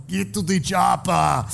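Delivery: slow, heavy Austrian action-hero voice; lightly imitating an action star; Arnold impression.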